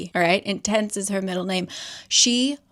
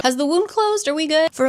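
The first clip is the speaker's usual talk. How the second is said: much higher and louder worried questions; anxious panic; 8 semitones higher, 5 dB louder than usual. Retold or imitated. Ty panicking about the blood, high and loud. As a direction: fret like the numbers scare you.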